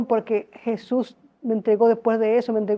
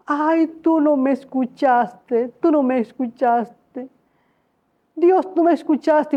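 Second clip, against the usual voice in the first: slow tearful lament; 2 semitones higher, slower than usual. Retold or imitated. Slow tearful complaint to God.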